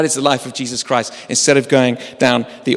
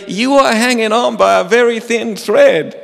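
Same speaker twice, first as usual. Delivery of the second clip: amused athlete; 7 semitones higher, 3 dB louder than usual. Retold or imitated. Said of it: "he says to Jerry".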